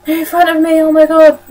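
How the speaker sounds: half-hearted fright. Retold or imitated. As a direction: play the scared woman without real effort. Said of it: "I can't even be asked to do a proper effort".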